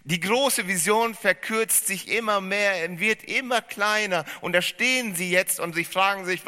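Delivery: laut, eindringlich gepredigt, hoch gespannt; predigend. Acted. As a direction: mit voller Kraft zur Gemeinde predigen. Preaching sermon opening. (volle Predigt, hoch und laut)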